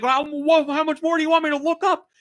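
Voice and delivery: high-pitched, spluttering protest; mock-flustered dad, voice way up; imitating indignant dad.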